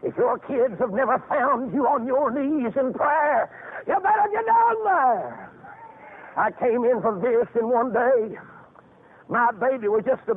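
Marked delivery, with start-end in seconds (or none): 3.9-5.5 preaching at full force. Acted. Preacher exhorting.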